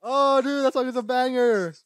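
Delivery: loud, high-pitched hyped reaction; excited enthusiasm. Real, not acted. Host reacting to the [name] song, excited enthusiasm. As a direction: gush loudly over a favourite track.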